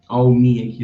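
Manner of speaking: ordinary delivery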